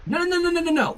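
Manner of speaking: high, rapid string of no's; emphatic no. Acted.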